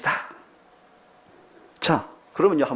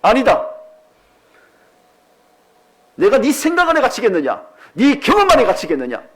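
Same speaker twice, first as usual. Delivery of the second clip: loud high booming rebuke; God rebuking; 9 semitones higher, 7 dB louder than usual. Acted. God rebukes Abraham's doubt, loud and high. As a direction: thunder back at the doubting man. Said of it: "하나님은 뭐라고 말하죠".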